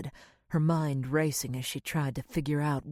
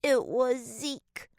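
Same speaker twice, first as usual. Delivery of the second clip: put-on high, slow little-girl voice, louder; lingering on the name through tears; tearful child; 9 semitones higher, 4 dB louder, slower than usual. A narrator's reading. Little girl insisting to mommy, slow and tearful. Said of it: "her daughter whimpered between sobs".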